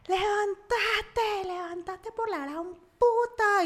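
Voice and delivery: high, drawn-out angry yelling; gritos imitados. Retold or imitated. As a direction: act out him screaming orders, stretching the words. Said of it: "Y me empieza a gritar". (gritos furiosos imitados, agudos y alargados)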